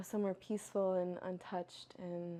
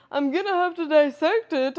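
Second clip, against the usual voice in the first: doctor's voice; 8 semitones higher, 15 dB louder than usual. Retold or imitated. Doctor quoted mid-emergency story.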